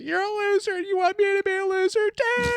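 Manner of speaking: mock teen meltdown, voice cracking high; bratty yell at dad. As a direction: scream it at your dad like a spoiled kid who just lost. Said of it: "he's yelling at his dad".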